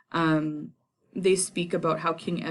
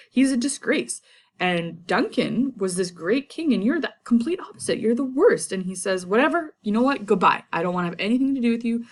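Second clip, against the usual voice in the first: higher, louder scornful scolding; angry outburst; 6 semitones higher, 3 dB louder than usual. Retold or imitated. Macduff rejecting Malcolm, high scornful anger. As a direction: spit out contempt and storm off. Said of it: "passionate outburst".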